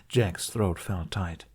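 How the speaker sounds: ordinary delivery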